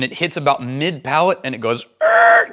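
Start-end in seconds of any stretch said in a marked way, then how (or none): 2.0-2.5 a sudden high strangled "er" like brakes locking; screeching halt noise. Retold or imitated. Describing the palate to viewers, then a high screeching halt noise. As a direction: squeal it out like the whisky slamming to a stop. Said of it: "comes to a screeching halt".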